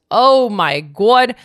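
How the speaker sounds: loud, slow exclamation; oh my god exclaim. Real, not acted. Remembering financial strain, a loud slow oh my God. Recalling money stress.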